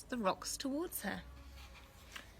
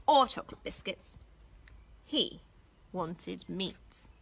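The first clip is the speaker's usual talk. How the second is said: ordinary delivery, nothing marked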